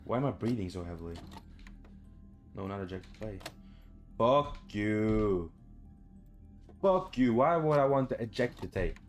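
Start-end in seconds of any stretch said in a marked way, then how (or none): none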